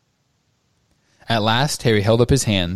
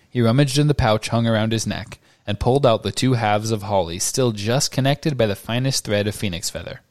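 ordinary delivery, nothing marked

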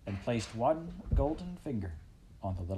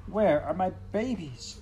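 high, loud cry of a worn-out mother mouse; exhausted mother; 9 semitones higher, 5 dB louder than usual. A narrator's reading. Tired mother mouse calling for her newborns, high and loud. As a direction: call out for the babies, spent. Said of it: "said the exhausted mother".

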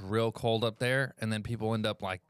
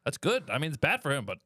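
put-on light, raised Irish accent, gloating a bit; mock Irish fan, half pleased; 4 semitones higher than usual. Irish fan gloating over Jimmy Butler's injury, mild Irish accent. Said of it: "He's like".

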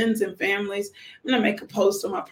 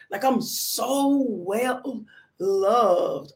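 very slow, stretched-out words; heartfelt emphasis; much slower than usual. Real, not acted. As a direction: draw out each word to savour it. Gushes about being loved.

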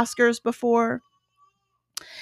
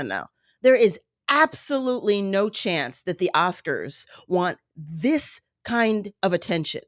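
usual speaking voice

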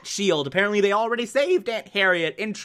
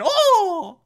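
high, sustained "oh!" of alarm; mock squeal; 13 semitones higher, 4 dB louder than usual. Reviewer imitating Aunt Harriet taken hostage, mock squeal. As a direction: squeal a comic cry of alarm.

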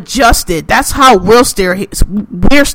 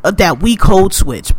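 ordinary talk

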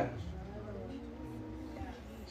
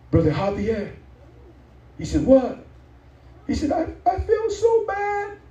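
quoting a crying sister, pitched way up; tearful high voice; 12 semitones higher than usual. Retold or imitated. Sister calling the pastor in tears, high tearful voice. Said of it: "and she's crying".

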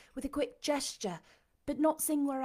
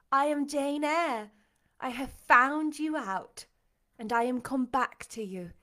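joyful declaration; 3 semitones higher, 6 dB louder than usual. A narrator's reading. Jane revealing herself to her master, joyfully earnest. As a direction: proclaim it, easing down at the end.

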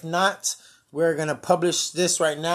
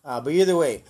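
ordinary talk

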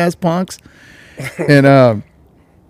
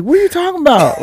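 high, mock-innocent denial; hammed-up fake innocence; playing dumb; 14 semitones higher than usual. Caught by the boyfriend, denying with high mock innocence.

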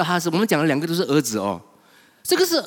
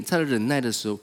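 ordinary delivery

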